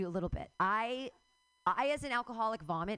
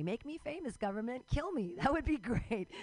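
ordinary delivery

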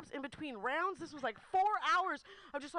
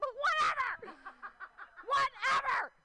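higher, laughing "whatever" shouted out; 9 semitones higher, slower than usual. Real, not acted. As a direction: brush it all off with a laughing yell.

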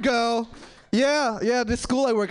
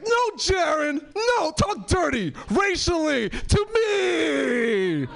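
high, drawn-out wailing demand; mock exasperated yell; 8 semitones higher, much slower than usual. Frustrated partner demanding different dirty talk, exasperated mock yell. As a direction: plead at high pitch, stretching the last words.

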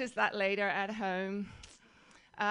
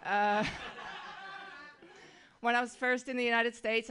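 ordinary talk

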